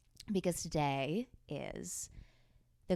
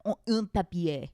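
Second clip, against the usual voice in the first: mock French accent; 6 dB louder than usual. Showing off school French.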